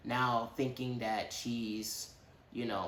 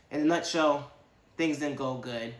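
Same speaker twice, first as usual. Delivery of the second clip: normal talking voice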